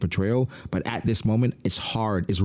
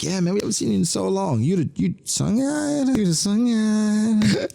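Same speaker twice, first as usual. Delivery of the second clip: squealing high fanboy voice, Sungyan drawn out slowly; giant fanboys; 12 semitones higher than usual. Retold or imitated. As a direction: gush like starstruck fans meeting their idol. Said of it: "They're like".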